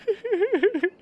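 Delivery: bright high giggle; high laugh. Real, not acted. Laughs at spicy food memory.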